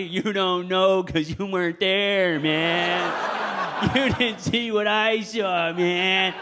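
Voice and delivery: slow, haunted vet drawl; like a traumatized vet; war veteran voice. Acted. Haunted swinger, Vietnam vet style.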